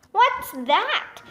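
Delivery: mild, high-pitched startled yell. A narrator's reading. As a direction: raise the pitch, not the volume. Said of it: "Emily yelled".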